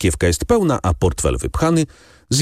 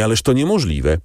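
higher, emphatic innkeeper's protest; exclaiming; 7 semitones higher than usual. A narrator's reading. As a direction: throw up your hands at the very idea. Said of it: "zawołał pan Pastrini". (wyższy, emfatyczny okrzyk oberżysty)

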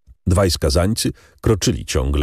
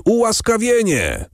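okrzyk tłumu. A narrator's reading. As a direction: cry it out far higher, like a crowd. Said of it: "zawołał lud". (wysoki, donośny okrzyk ludu)

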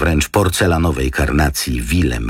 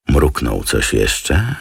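ordinary delivery

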